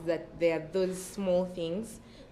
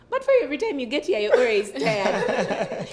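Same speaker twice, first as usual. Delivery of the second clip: lightly acted guard, higher and louder, laughing; imitated puzzled guard; 6 semitones higher, 7 dB louder than usual. Security guard questioning the tired student, lightly mimicked exclaiming. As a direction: exclaim it in mock bewilderment, breaking into laughter.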